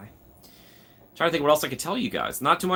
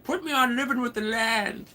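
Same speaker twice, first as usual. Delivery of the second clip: put-on old man voice; 11 semitones higher than usual. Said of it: "I'm becoming such an old man".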